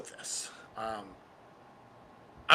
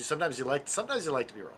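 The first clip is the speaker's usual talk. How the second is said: ordinary talk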